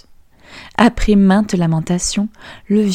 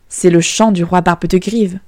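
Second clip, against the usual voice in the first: ordinary delivery, nothing marked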